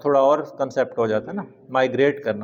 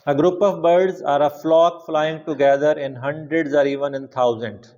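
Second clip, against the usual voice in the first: slow dictation; much slower than usual. Shown as a demonstration. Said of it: "slow dictation".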